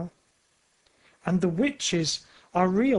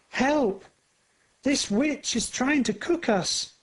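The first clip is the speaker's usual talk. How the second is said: put-on children screaming; 7 semitones higher than usual. A narrator's reading. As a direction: scream in fear. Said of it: "a boy and a girl scream together".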